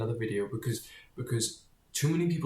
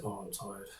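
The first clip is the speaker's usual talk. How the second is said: sleepy mumble; 3 semitones lower, 13 dB quieter than usual. Retold or imitated. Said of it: "I said".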